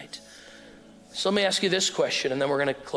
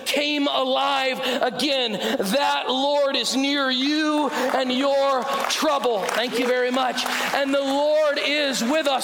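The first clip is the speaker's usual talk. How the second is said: preaching at full force; 9 semitones higher than usual. Acted. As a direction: proclaim it slowly to the crowd, rising. Sermon climax preaching.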